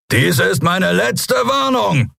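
high, drawn-out roar; roared warning. A narrator's reading. Skria captain warns the angry crowd, roaring. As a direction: bellow slowly over a crowd. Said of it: "brüllte er".

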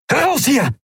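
high, urgent shouted order; urgent call. A narrator's reading. Leader calls his crew out of a trap, urgent high-pitched call. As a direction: call out high to get everyone moving. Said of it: "rief er und machte auf dem Absatz kehrt".

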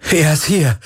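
high, exultant cry; jubilant. A narrator's reading. Galen telling Ki his friend is here, jubilant. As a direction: burst out with joy. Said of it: "jubelte Galen".

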